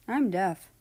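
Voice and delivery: plain reading voice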